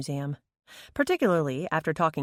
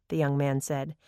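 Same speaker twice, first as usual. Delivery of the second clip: ordinary delivery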